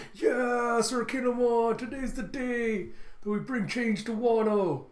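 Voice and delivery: high, loud mock battle cry; rousing crowd cheer. Samurai answering Kinemon as he raises his sword, rousing shout. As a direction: shout it drawn out like cheering warriors.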